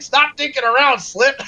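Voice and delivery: Peppy's line shouted high and loud; imitating Peppy. Quoting Peppy's line to his friend, a loud high mock shout. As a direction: bark it like the old sixty-four pilot. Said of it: "when Peppy's like".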